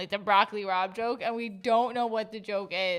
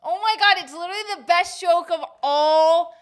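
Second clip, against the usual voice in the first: excited; 7 semitones higher, 6 dB louder than usual. Real, not acted. Excited frustration, can't recall joke.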